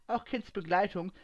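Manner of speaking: plain reading voice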